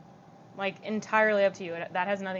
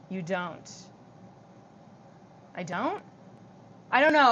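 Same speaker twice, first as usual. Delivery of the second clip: ordinary talk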